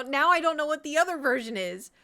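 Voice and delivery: loud, high exasperation; real frustrated cry; frustrated outburst. Real, not acted. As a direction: throw up your hands and yell it. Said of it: "Bro, I'm losing it".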